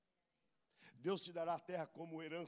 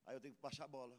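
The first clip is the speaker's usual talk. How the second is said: normal talking voice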